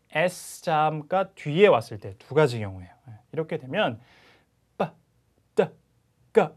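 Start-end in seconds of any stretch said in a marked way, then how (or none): 4.8-6.5 빠, 따, 까 tight, with no puff of air; unaspirated consonants. Shown as a demonstration. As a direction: separate tense sounds 빠, 따, 까. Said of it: "이런 발음이 나와요". (바람 소리 뺀 된소리 빠, 따, 까)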